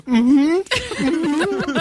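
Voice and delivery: strained mm-hmm through held laughter; stifled giggles. Retold or imitated. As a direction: mimic holding in a laugh. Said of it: "I'm like".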